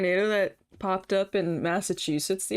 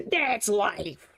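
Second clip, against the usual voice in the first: drawn-out, hammy catchphrase; hammed-up exclamation. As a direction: play it up as a silly proclamation. Repeats it as exaggerated exclamation.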